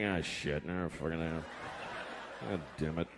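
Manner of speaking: low, quiet disgusted groan; mock miserable guy. Comic imitates a guy cursing after masturbating. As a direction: grumble it low like a guy hating himself. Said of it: "The guys are like".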